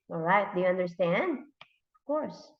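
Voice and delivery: ordinary talk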